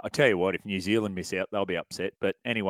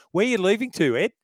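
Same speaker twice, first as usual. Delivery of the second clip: ordinary talk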